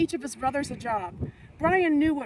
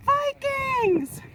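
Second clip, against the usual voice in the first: high urgent cry of Vikings; exclaimed warning; 12 semitones higher, 5 dB louder than usual. A narrator's reading. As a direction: shout the warning, pitched way up. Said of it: "exclaimed Brian".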